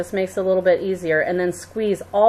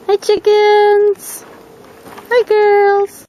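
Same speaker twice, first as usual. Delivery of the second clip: high, loud drawn-out call to animals; 13 semitones higher, 8 dB louder, much slower than usual. Real, not acted. Host greeting the chickens in the backyard, calling out high and loud. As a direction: holler a sing-song greeting across the yard.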